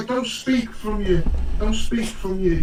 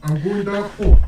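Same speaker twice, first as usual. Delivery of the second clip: louder mock-British demo; put-on British lad; British accent; 6 dB louder than usual. Shown as a demonstration. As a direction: show the British pub line. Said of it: "original line".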